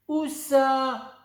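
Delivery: squinting in doubt; frowning girl. A narrator's reading. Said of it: "en fronçant les sourcils". (fillette qui fronce les sourcils)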